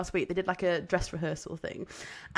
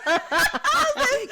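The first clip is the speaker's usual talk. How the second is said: laughing; 7 dB louder, much slower than usual. Real, not acted. Laughing while retelling.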